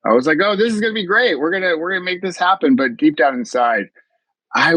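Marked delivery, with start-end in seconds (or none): 0.4-2.8 higher-pitched, forced upbeat cheer; mock-cheerful reassurance, pitched up; putting on a happy face. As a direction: imitate yourself faking optimism, pitch lifted. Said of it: "tried to put on a happy face".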